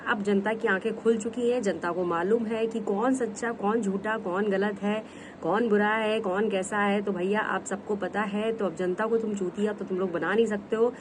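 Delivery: ordinary talk, nothing marked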